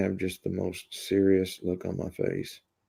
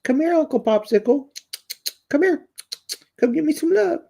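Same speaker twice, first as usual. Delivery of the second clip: high, sing-song pet voice, louder; 5 dB louder than usual. Real, not acted. Calling his Chihuahua Uncle Popsicle over: high baby talk.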